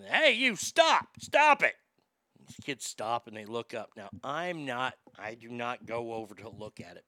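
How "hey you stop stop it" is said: high, loud shout from the balcony; grandma yelling. Retold or imitated. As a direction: holler down at the kids to stop. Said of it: "And she yells down".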